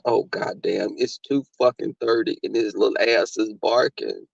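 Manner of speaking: low, soft half-asleep grumble; sleepy muttered complaint in the dark; groggy mutter. Retold or imitated. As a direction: grumble it like you just woke up.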